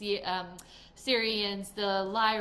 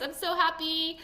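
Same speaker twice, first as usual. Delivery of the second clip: ordinary delivery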